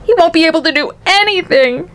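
high-pitched distressed mother; acted cry of dismay; cried. A narrator's reading. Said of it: "cried Missus T V".

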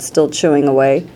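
plain reading voice

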